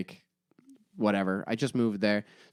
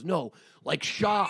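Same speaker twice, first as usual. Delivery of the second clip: high, drawn-out Boston impersonation; thick Boston accent; 7 semitones higher, 3 dB quieter, much slower than usual. Retold or imitated. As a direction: stretch "sharks" in broad Bostonian. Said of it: "he's got a real thick Boston accent".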